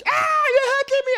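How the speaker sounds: high shrieking demon cry; demon in pain. Retold or imitated. Demon crying out as the preacher drives it out.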